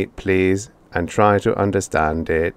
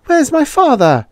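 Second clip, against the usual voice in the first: put-on high, louder boy's voice asking; anxious boy; 5 dB louder than usual. A narrator's reading. Boy asking the robot for his parents, high and worried. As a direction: plead like a worried child.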